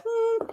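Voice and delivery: high, soft questioning hum; a small high-pitched "hmm" of doubt; doubtful hmm. Retold or imitated. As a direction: squeak out a quiet hum. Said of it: "things that I kinda go".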